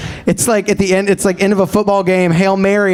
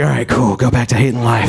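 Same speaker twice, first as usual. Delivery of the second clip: deadpan; 6 semitones lower than usual. Retold or imitated. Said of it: "everyone's just like".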